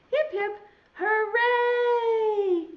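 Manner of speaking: high, slow hip hip hooray; teacher's big slow cheer. Shown as a demonstration. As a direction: cheer it like a children's party. Cheer ending the song.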